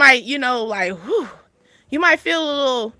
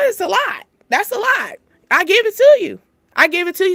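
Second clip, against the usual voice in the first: much higher, louder emphatic praise; big emphatic props, voice up high; 9 semitones higher, 5 dB louder than usual. Real, not acted. Praising men who deal with women, high and emphatic.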